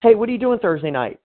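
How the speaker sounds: cheery invitation to a friend; upbeat phone invite. Shown as a demonstration. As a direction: pitch it like an easy casual hello. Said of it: "call someone up and say".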